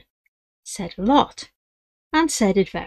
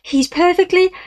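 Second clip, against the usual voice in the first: cut off mid-boast; 11 semitones higher, 6 dB louder than usual. Acted. Cut off by a fall.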